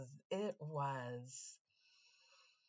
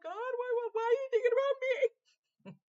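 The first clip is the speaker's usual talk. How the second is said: very high, loud mock wail; hammed-up squealed protest; overwhelmed high plea; 9 dB louder than usual. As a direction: cry out to God, overwhelmed.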